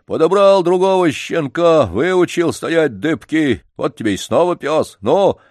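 a janitor haggling over the dog, insistent; pushy janitor. Acted. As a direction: coax the old man to sell. Janitor voiced in story.

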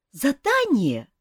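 high, eager question; perked-up boy. A narrator's reading. Young prince hearing of a task for him, excited. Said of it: "Мальчишка оживился".

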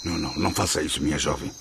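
low, hushed repeated no, pleading; anguished plea. Acted. Camilo begs the young woman not to end her life, low and hushed. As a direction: beg her softly not to do it.

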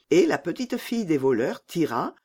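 plain reading voice

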